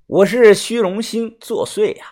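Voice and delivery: usual speaking voice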